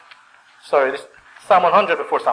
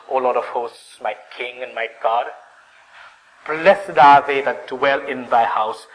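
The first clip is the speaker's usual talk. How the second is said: slow, drawn-out psalm verse; pondering; slower than usual. Acted. Worshipper watching sparrows in the temple, slow and pondering. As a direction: dwell on each phrase as if musing. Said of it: "and he thinks".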